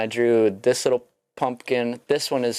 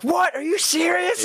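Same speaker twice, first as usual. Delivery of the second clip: high, incredulous mock gasp of a question; imitating Grimm's dad's startled reply; surprised disbelief. Telling the stream how Grimm's dad reacted, in high surprised disbelief. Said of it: "he's like".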